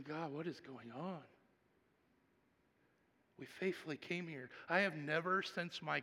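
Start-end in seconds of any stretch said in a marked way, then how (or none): 0.0-1.2 soft, hushed plea to God; barely voiced, pleading upward; hushed prayer. Pastor retelling his tearful plea to God, soft and hushed.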